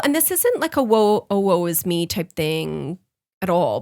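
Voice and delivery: ordinary talk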